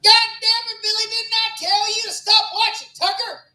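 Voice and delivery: high, loud, yelled southern twang. Pretend. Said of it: "That's my impression of a redneck".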